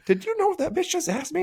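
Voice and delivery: very high incredulous question; outraged disbelief. Retold or imitated. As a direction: squeak it, scandalized. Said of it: "he goes".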